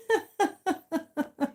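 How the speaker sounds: high, pulsing peal of laughter; delighted laugh. Real, not acted. Amused greeting of a guest.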